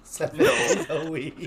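loud slow hype line; hyped. Real, not acted. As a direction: call it out like a hype chant. Hyped joke chant.

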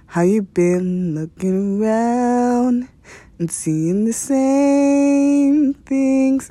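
slow, dreamy spoken-word delivery, drawn out; mock poetry reading. Spoofing a poem for podcast listeners, slow and dreamy. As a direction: ham it up like a wistful poet reciting.

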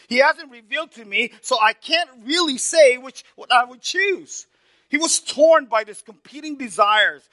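high, loud, fervent preacher's cry, stretched slow; full-force preaching. Acted. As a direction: pour out Paul's words to the congregation at full force. Preaching Paul's words at full force.

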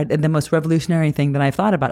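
normal talking voice

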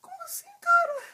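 put-on very high cracking teenage squeak. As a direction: mimic a breaking adolescent voice. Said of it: "Aquela voz de adolescente".

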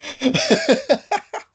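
high-pitched burst of laughter. Real, not acted. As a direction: laugh out loud in a high staccato run. Laughs at guest's teasing.

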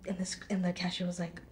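usual speaking voice

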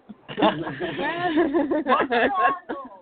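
long, loud burst of laughter; overwhelmed laugh. Real, not acted. Caller overwhelmed after a healing session, laughing loudly. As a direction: laugh out loud, helplessly, for a few seconds.

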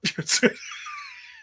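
high shrieking laugh. Real, not acted. Laughs at own joke.

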